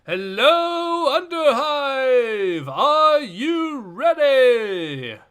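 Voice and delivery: loud, drawn-out arena announcer. Acted. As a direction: hype up the crowd like a ringmaster. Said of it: "I'll do the voice of the announcer here".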